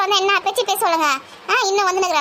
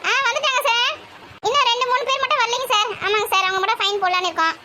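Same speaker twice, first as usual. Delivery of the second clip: high, calling phone voice; loud phone voice; 5 semitones higher than usual. Acted. Reporting attendance to sir on poor signal, loud phone voice. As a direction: keep shouting lightly into the phone. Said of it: "அதான் கத்தி கத்தி பேசுறேன்".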